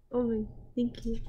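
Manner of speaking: ordinary talk, nothing marked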